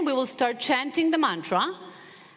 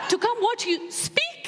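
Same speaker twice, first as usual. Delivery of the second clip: much higher, breaking into laughter mid-quote; laughing through the question; 12 semitones higher than usual. Real, not acted. Retelling the old man's question to the audience, laughing. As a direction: laugh as you repeat his doubt.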